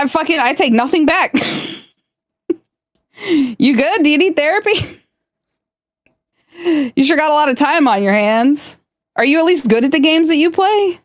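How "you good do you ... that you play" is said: mock degrading. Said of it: "Degrade me right now".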